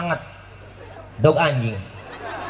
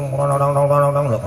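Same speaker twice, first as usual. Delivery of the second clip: rhythmic mouth-made exhaust pops; motor exhaust imitation; slower than usual. Preacher mimics kids' racing motorbikes, exhaust noise. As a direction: pop out the revving exhaust like a racing bike.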